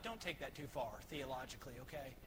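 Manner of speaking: quick quiet aside. Real, not acted. Preacher warning the congregation aside, softly and fast. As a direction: drop the voice for a quick caveat.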